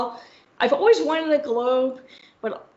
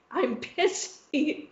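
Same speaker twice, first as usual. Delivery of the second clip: "I'm pissy" stretched very slow and soft; drawn-out pissy; 5 semitones higher, 5 dB quieter, much slower than usual. Real, not acted. The host tells friends how cross she was, slow and sulky. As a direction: savour "pissy" slowly, sulking. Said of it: "And I'm pissy".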